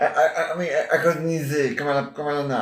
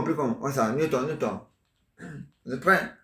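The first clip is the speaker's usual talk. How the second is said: normal talking voice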